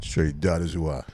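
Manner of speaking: low slow put-on voice. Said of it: "What voice you using?".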